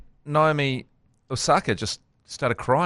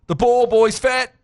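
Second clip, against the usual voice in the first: high, loud, slow mocking yell; hammed-up drunken taunt at the ball boy; drunk heckler yell; 11 semitones higher, 4 dB louder than usual. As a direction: bellow it out like a drunk fan in the stands. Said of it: "he yells something obscene".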